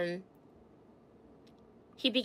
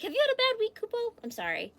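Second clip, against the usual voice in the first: high, tender concerned voice; sympathetic; 11 semitones higher than usual. Real, not acted. Sympathizing with chatter.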